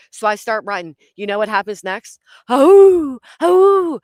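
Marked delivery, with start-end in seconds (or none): 2.5-4.0 loud, high owl hoot; long swooping hoot, pitched way up; imitated owl hoot. Storyteller imitating the owl that follows her, loud hoot. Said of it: "The owl".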